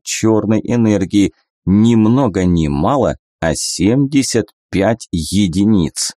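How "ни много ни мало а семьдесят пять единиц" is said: plain reading voice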